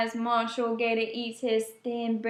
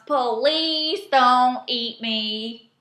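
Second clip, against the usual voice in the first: slow, sarcastic mock fear; 6 dB louder, much slower than usual. A narrator's reading. Otter mocking the gator with fake fright, slow and sarcastic. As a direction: pretend to be scared, all sarcasm. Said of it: "he mocks".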